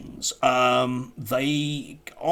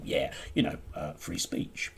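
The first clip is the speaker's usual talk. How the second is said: lower, whispery, offhand mimicry; mock official brushing off free speech; mocking dismissive quote; 6 semitones lower, 5 dB quieter than usual. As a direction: toss it off like a shrugging official. Mocking a university spokesman.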